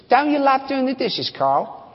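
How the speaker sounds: high-pitched mock Australian accent; Australian wife.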